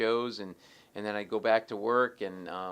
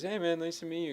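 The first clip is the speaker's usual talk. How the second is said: higher-pitched, lightly imitated friendly star; 7 semitones higher than usual. Music star greets a fan, higher imitated voice. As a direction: greet the stranger in a higher put-on voice. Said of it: "He goes".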